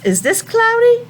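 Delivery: high rising playful question; singsong question to children; high questioning teacher voice. Shown as a demonstration. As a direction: ask it up high like to little kids.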